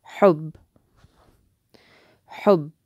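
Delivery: "hub" ending cleanly on the b, no vowel after it; stopped final consonant. Shown as a demonstration. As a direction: stop dead on the b of "hub". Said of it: "stopping on a sound".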